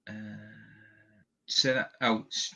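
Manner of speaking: normal talking voice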